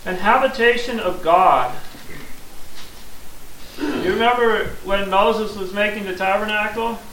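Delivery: ordinary talk, nothing marked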